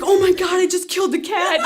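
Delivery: high, loud horrified outburst; hammed-up alarm, voice jumping up; retold panic. She thinks her finger snap killed the cat, panicked.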